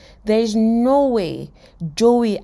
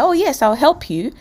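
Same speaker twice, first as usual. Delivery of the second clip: higher, obliging acted reply; eagerly agreeing; 8 semitones higher than usual. Phoebe agreeing to teach her friend French, eagerly. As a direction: agree at once, pitched up.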